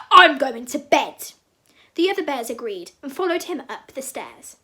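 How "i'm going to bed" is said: sigh, then loud, slow bear voice; big grumpy bear. A narrator's reading. Papa Bear gives up for the night, loud and grumpy. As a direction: huff and announce it loudly, grumpily. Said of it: "grumpy voice".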